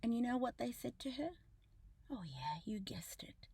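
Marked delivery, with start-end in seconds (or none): 2.1-3.5 whispered, knowing sarcastic aside; hushed sarcastic aside to viewers. Real, not acted. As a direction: lean in and whisper it like a secret.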